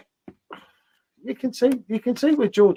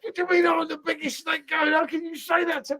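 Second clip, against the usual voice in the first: mock outrage; 7 semitones higher than usual. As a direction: act offended and raise your voice. Mock-offended protest.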